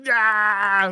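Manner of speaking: high, loud hammed-up scream; mock scream. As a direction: imitate a pinned man's yell. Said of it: "just like".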